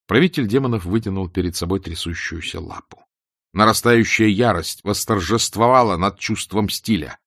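plain reading voice

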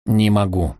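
ordinary delivery, nothing marked

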